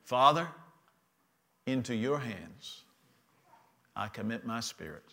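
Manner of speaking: quiet surrender. Acted. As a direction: hand over the spirit in a last breath. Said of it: "cried out and said".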